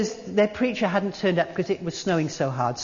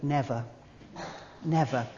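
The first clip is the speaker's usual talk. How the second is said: never said softer and lower, lingering; stress on never; 6 semitones lower, 8 dB quieter than usual. Shown as a demonstration. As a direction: savour the word never. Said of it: "a beautiful word".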